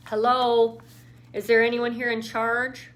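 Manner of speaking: loud call down an empty hall; Lauren calling out for someone in charge. Acted. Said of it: "she called out".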